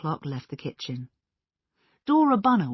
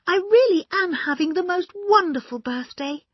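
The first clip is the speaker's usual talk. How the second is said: high, loud, delighted gush; excited; 9 dB louder than usual. A narrator's reading. Dora at her birthday tea, excitedly high. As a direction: beam with birthday joy. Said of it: "Her cheeks were red with excitement".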